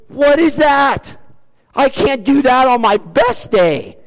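overwhelmed and amazed, drawn out; astonished disbelief. Retold or imitated. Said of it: "you're saying".

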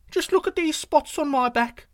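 high, loud and quick bragging deer voice; boastful little deer. Acted. Yu Yu bluffs the tiger about his spots, high and boastful. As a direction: puff yourself up, a tiny creature bluffing big.

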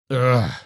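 loud snarled "ugh"; wolf's snarl. A narrator's reading. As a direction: snarl out a disgusted wolf noise. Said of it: "snarled the wolf".

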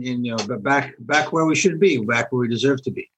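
usual speaking voice

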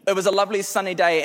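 ordinary talk, nothing marked

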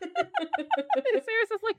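ordinary delivery, nothing marked

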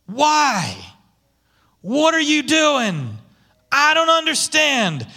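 high, slow anguished cry of why; mild retold scream at God. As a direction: plead upward in frustration. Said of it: "Screaming at God".

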